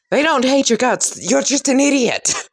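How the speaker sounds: high-pitched scolding comeback; exasperated retort. Acted. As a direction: correct them at the end of patience. Character snaps back at complaint.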